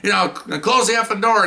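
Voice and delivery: high-pitched imitated boss's yell; retold yelling. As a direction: order him in, mock shouting. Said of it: "now he's yelling at this one detective".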